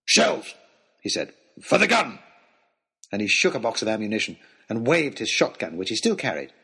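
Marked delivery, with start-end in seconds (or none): 0.1-2.2 wild hunter. A narrator's reading. Wild hunter raving about his gun.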